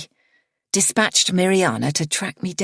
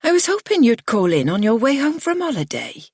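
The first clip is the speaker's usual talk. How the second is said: reproachful; 8 semitones higher, 3 dB louder than usual. A narrator's reading. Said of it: "fades to reproach".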